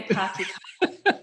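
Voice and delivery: slow, loud Aussie "pahk ya cah"; Australian vowels with the R dropped, drawn out; Australian accent. Shown as a demonstration. Comparing with Boston for the host, broad Australian accent. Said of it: "that's a bit Australian 'cause we don't pronounce the R".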